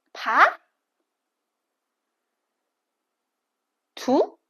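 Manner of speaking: each syllable sliding up like a question; rising second tone. Shown as a demonstration. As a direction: ask every syllable like a curious child. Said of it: "you are a children".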